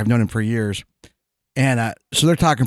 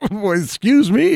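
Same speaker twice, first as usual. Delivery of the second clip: scoffing; 10 semitones higher, slower than usual. Retold or imitated. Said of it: "she scoffed and was like".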